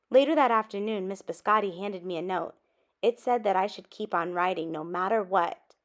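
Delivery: ordinary delivery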